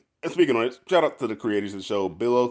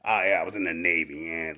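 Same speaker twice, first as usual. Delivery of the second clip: lightly voiced gruff older man, lower pitch; gruff old-timer; 5 semitones lower than usual. [name] recalling his Navy days, lightly gruff. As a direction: drop it low for a hint of a gruff navy vet. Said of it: "like, fucking gruff".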